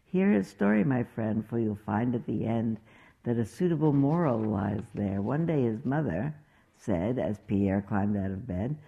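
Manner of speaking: lively storybook read with voiced characters; reading to children. Reading the Pierre story aloud to listeners, animated storybook voice. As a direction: read it like bedtime story time.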